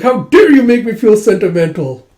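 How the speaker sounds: high, loud hammed-up indignation; mock indignant outburst. Nobleman moved by praise, loud mock outrage. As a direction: protest loudly, half-touched. [name] overwhelmed by emotion.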